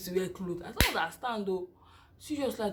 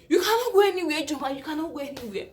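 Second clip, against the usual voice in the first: nurses holding her back; 6 semitones higher, 5 dB louder than usual. Retold or imitated. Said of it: "the way they held me".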